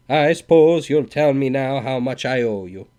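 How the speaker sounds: loud, sneering demand; insolent. A narrator's reading. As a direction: goad them with it. Insolent Swede demands bill.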